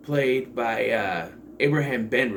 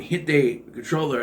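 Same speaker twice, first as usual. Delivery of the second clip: ordinary talk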